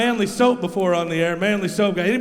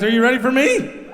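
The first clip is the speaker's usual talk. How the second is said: hype crowd call; 5 semitones higher than usual. Real, not acted. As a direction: fire up the crowd. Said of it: "I like to get the energy up".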